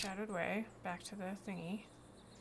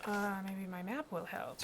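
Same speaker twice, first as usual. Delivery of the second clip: normal talking voice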